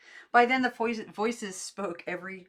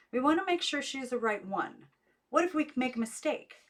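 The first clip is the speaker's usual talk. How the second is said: distant voice; 7 semitones higher, 4 dB louder than usual. A narrator's reading.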